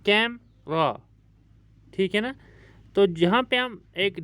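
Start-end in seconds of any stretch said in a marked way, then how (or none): 0.1-0.9 camera split as कैम, pause, रा; syllable break. Shown as a demonstration. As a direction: say camera in two separate beats. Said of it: "इसमें दो syllable हैं". (Camera को तोड़कर, कैम... रा)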